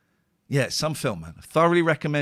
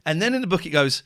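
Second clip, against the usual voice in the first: usual speaking voice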